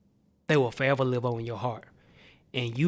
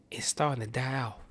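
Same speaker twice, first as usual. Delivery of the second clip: sudden whisper; 5 dB quieter than usual. Acted. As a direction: drop straight to a whisper. Flesh dying off after loud build.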